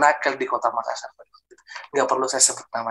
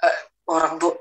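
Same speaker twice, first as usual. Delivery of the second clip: ordinary talk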